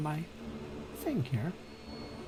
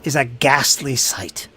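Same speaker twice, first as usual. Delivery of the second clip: dramatic narration with heavy stress and drops; game master's scene setting; 6 dB louder than usual. Acted. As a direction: set a grisly scene, lingering on key words.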